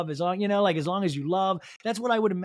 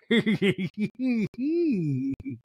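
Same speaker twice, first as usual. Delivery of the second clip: imitated cackle; 3 semitones higher than usual. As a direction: put on a squealing cackle. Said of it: "And Meredith goes".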